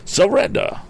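high, theatrical triumphant cry. A narrator's reading. Mister Damon corners the thieves, high triumphant cry. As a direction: pitch it up like an excitable gentleman. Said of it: "he cried".

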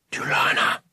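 hushed whisper of a name. A narrator's reading. Boy whispering to someone scratching at his door, whispered. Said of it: "he whispered".

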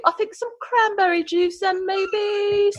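put-on high-pitched, playful British accent; chatty mock-British back-and-forth, pitched well up. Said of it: "learning British accent".

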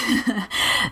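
breaks into a giggle; can't hold back a laugh. Real, not acted. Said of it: "I have got to control myself".

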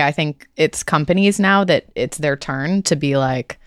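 ordinary talk

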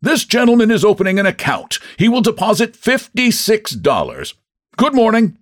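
high-pitched public announcement; loud, officious bank manager; unkindly loud. A narrator's reading. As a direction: announce it tactlessly for all to hear. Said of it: "he said, unkindly loud".

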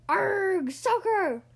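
loud, high pirate echo; loud rowdy pirate cry; crew yell. A narrator's reading. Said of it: "the crew yelled".

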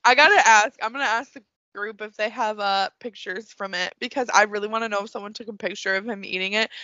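normal talking voice

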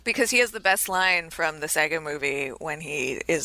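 ordinary delivery

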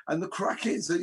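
ordinary talk

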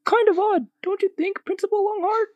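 put-on comic teacher in a squeaky Spanish accent; attempted Spanish accent. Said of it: "do a Spanish accent".

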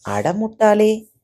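slow, high, exasperated "அடமுட்டாளே"; scolding. Acted. Master scolds his foolish servant, slow exasperated rebuke. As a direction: drag out the insult in disbelief.

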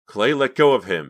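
high, loud urgent command; insistent. A narrator's reading. Pam orders Clay to release the cashier, insistent. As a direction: order him off, alarmed. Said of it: "Pam insisted".

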